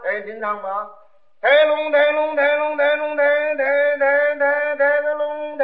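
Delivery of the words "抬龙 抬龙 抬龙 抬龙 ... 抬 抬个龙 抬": chanted gong and drum rhythm, slowing at the end; mouth percussion. Pretend. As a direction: drum the band in with your voice. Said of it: "您，您打架".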